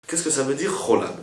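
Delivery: ordinary talk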